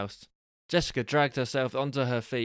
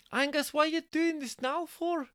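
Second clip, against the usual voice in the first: very high falsetto Scottish complaint; exaggerated high Scottish woman's voice. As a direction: squeak it out as a Scottish wife. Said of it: "turns out that Jessica is actually Scottish".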